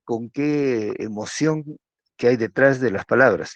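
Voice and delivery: normal talking voice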